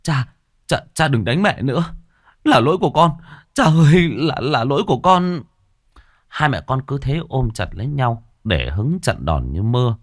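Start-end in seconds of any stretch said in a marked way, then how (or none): 0.0-5.4 high, stammering plea; trembling, breathless. Acted. Frightened son takes blame to protect mother, trembling and breathless. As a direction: beg shakily, struggling to get words out. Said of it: "Nó run rẩy nói không thành hơi".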